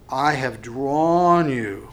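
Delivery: slow, weighty delivery of 'I have drawn you'; slow stress on drawn. Shown as a demonstration. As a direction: lean on each word of 'I have drawn you', slowly. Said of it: "here's the word, look at the text".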